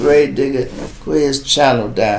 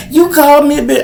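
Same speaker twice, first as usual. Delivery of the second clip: offended woman; 13 semitones higher, 8 dB louder, faster than usual. Retold or imitated. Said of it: "She like".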